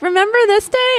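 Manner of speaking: imitating a bright Snapchat reminder; mock app prompt. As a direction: chirp it like a cheery app.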